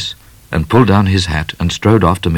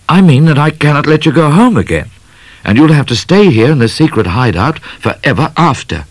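higher, louder, urgent declaration; emphatic bushranger; 9 semitones higher, 4 dB louder than usual. Acted. Midnight telling Laura she must stay, emphatic bushranger. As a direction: announce it with raised voice.

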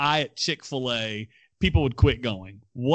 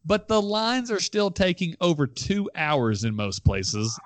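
normal talking voice

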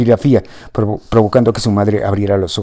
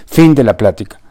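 final, firm; 2 semitones higher, 6 dB louder than usual. A narrator's reading.

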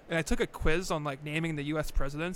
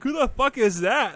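high, loud mock shout of disbelief; 8 semitones higher, 11 dB louder than usual. Retelling classmates mocking his impression, mock yell. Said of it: "they're like".

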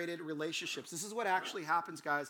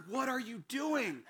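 high, drawn-out disbelief; shocked; 6 semitones higher, slower than usual. Retold or imitated. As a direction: act out a horrified friend's question. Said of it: "even looked shocked".

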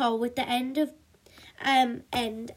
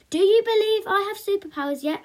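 high, eager, loud question; bright-eyed hope, pitched up; hopeful; 9 semitones higher, 6 dB louder, faster than usual. A narrator's reading. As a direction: superhero mum seeking belief. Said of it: "asked Super Mum hopefully".

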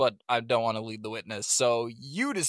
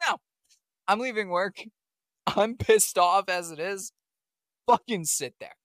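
mock irritated rant; 8 semitones higher, 5 dB louder than usual. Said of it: "and I was like".

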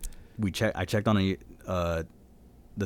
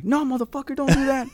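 way up high, squeaky protest; high mock retort. Telling his friend no in a squeaky high protest.

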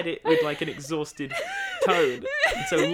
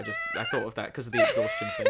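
laughing. Real, not acted. Cracking up explaining.

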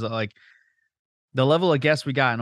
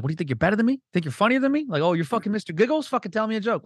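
much higher, hammed-up Italian tough guy sneering; mock Italian guy; 9 semitones higher than usual. Scornful Italian mocking someone starting comedy, hammed-up high voice. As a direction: needle a wannabe comic like a scornful Italian. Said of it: "a fucking Italian".

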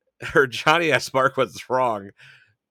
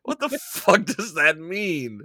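baffled; 5 semitones higher, slower than usual. Real, not acted. Baffled at the movie line.

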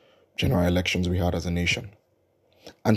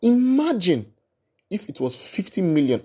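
emphatic; 8 semitones higher than usual. Real, not acted. Rhetorical emphasis.

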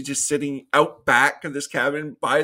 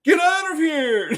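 very high mock holler; hammed-up urgent shout; retold yell; 11 semitones higher, 4 dB louder than usual. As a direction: mimic a frantic man yelling her off. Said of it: "and you yell her name".

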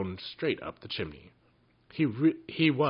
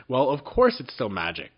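louder, impatient exclamation; impatient cry; 4 semitones higher, 6 dB louder than usual. A narrator's reading. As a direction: snap it out impatiently. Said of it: "cried Jinx impatiently".

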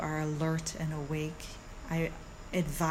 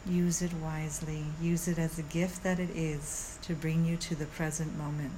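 soothing yoga teacher; 3 dB quieter than usual. Acted. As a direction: guide them calmly through the body. Leads a guided meditation.

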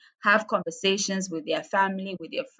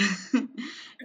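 small, higher-pitched laugh; short laugh; 3 semitones higher, 4 dB quieter than usual. Real, not acted. Guest faced with a big question, short laugh. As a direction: let out a brief laugh mid-answer.

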